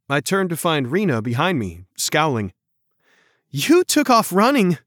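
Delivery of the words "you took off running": higher, louder, breathless; out of breath. A narrator's reading. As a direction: pant the words after a sprint. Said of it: "panting as she stepped up".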